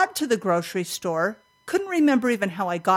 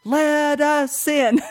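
high, drawn-out imitation of kids at the door; mock child's whine, stretched out; whining kids; 7 semitones higher, 3 dB louder, much slower than usual. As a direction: plead slowly like children locked out. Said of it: "it was the kids pounding on the door".

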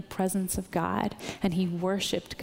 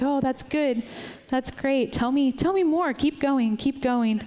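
excited God; 4 semitones higher than usual. Retold or imitated. God urging the speaker on at the table, excited and eager. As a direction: cheer the listener on to keep talking. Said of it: "He's like".

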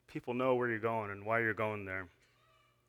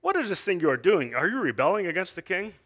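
jeering; 6 semitones higher, 5 dB louder than usual. A narrator's reading. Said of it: "they jeered at us and despised us".

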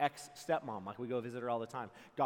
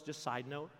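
ordinary talk

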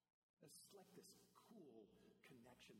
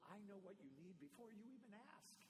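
higher, earnest invitation to just talk; inviting God; 9 semitones higher than usual. Retold or imitated. God invites the listener to talk, eager and higher. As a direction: reach out gently to connect. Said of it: "No, God goes".

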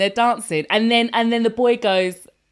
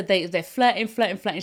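ordinary delivery

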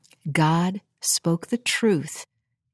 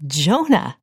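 surprised exclamation. Real, not acted. Sudden realisation about Jonah.

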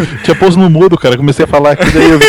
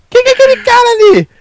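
surprised high exclamation; faster than usual. Retold or imitated. Retold shock at short guy.

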